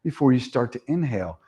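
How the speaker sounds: steady, calm breathing cues; guided meditation. Acted. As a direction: lead the breath gently.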